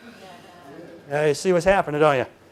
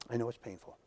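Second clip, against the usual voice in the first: gentle comfort; 6 semitones lower, 13 dB quieter than usual. Retold or imitated. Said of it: "He says".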